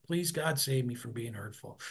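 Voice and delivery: soft, hushed plea to God; quiet prayer. Retold or imitated. Man quoting his daily prayer, soft and hushed. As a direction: murmur it like a private prayer. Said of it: "I pray every day".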